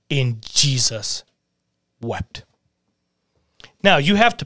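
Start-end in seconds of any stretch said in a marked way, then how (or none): 0.1-2.4 slow and hushed, the words sinking; solemn, slow. Acted. Preacher reading Jesus weeping to the congregation, slow and hushed. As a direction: let the verse fall quietly, with weight. Shortest verse read solemnly.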